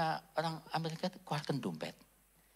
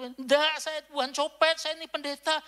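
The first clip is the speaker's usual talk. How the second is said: high, loud denial; indignant protest; 12 semitones higher, 8 dB louder than usual. Retold or imitated. Pastor protesting his innocence to the crowd, loud and indignant. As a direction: defend yourself, outraged. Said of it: "Dia sih mempertahankan".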